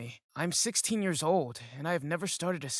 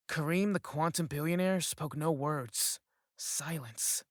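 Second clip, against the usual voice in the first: usual speaking voice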